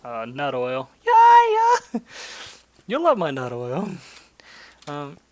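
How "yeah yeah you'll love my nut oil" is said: squeaky, high, mock-salesman squeal; goofy joke voice. Player joking to viewers about nut oil, goofy high voice. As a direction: ham it up like a silly cartoon pitchman.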